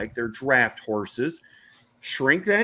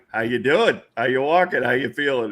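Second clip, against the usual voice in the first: teasing ribbing; 3 dB louder than usual. Retold or imitated. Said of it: "We're dogging him now".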